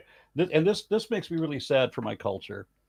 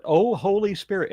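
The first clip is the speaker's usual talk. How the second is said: mild mock scream; 7 semitones higher than usual. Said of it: "and run away screaming".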